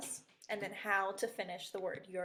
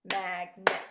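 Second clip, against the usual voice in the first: "Magnet" in two punched beats, MAG-NET, louder; clapped out by syllables; 10 dB louder than usual. Shown as a demonstration. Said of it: "how many syllables does that have".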